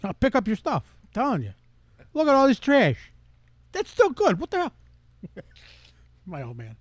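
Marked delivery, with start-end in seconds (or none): 0.0-4.7 high, loud hammed-up ranting; angry Nicky Geronimo. Host as Nicky ranting about trash, high and hammed-up. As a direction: rant at the trash, overdone. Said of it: "Nicky Geronimo".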